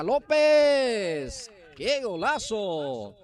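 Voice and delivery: long, high, loud goal call; drawn-out goal shout. Real, not acted. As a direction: stretch the goal cry out at full voice. Goal scored, commentator erupts.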